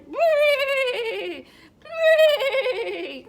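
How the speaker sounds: loud high falling bray; zebra bray. Shown as a demonstration. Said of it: "Like this?".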